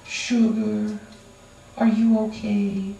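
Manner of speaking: put-on baby them with sugary words; mock-tender coddling of a friend. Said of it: "we sit and we go".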